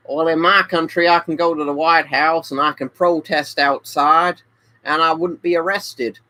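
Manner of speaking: put-on light, lifted American accent. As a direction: play the smug American. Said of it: "I'll do the accents".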